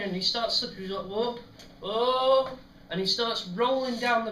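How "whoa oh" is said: higher, louder drawn-out whoa; mock alarmed cry. Steve slipping on the coal pile, mock alarmed cry. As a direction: imitate someone losing their footing.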